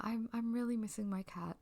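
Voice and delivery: ordinary talk, nothing marked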